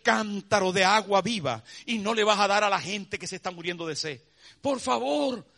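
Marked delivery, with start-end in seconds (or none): 4.6-5.5 loud, high fervent preaching; preaching climax. Acted. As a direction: build to the pulpit climax. (clímax de predicación a toda voz)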